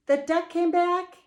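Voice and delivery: high, loud surprise; excited storyteller reveal; surprised reveal. A narrator's reading. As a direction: tell it as a shocking twist.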